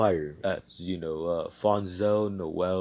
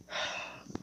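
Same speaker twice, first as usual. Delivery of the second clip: exasperated sigh; 9 dB quieter than usual. Acted. Asta exasperated at Mars.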